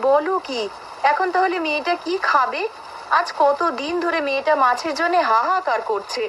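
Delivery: very high-pitched wifely lament; high-voiced scolding dismay; dismayed complaint. Wife dismayed there is no fish.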